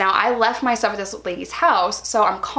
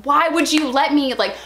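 mad; 6 semitones higher than usual. Retold or imitated. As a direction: yell at him for stopping you. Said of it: "And I was so mad".

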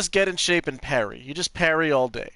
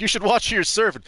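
higher, louder mock tough-guy warning; quoting the tough guy; 7 semitones higher, 7 dB louder than usual. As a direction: throw the line back like a bouncer's threat. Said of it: "he was just like".

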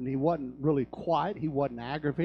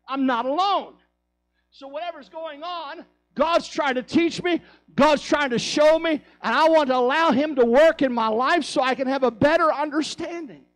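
loud, high, fervent preaching; rising hellfire sermon voice; preaching at full force; 10 semitones higher, 9 dB louder than usual. Acted. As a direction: lift the room, full throated.

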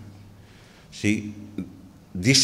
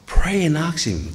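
higher, slow, urgent "pray and ask him"; an earnest call to pray; urgent plea; 8 semitones higher than usual. Acted. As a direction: press them hard to pray. Preacher urging congregation.

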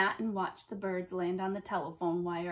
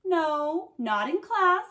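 loud and higher, stopping the class; booming; 5 semitones higher, 5 dB louder, much slower than usual. A narrator's reading. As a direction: boom it out to freeze everyone. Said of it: "she boomed".

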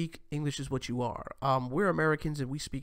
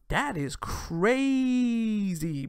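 disbelief; 7 semitones higher, 4 dB louder, much slower than usual. Real, not acted. Reacting in disbelief.

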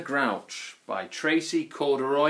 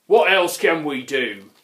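burping troll; 8 dB louder than usual. A narrator's reading. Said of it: "burped the Grunt".

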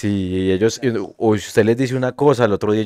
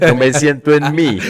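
laughing quote; 6 semitones higher, 4 dB louder, slower than usual. Real, not acted. Laughing while quoting.

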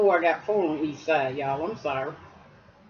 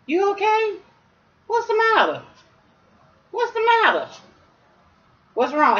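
very high, tender asking after the dog; 13 semitones higher than usual. Real, not acted. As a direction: soothe the pup in a high coo. Owner comforting upset dog.

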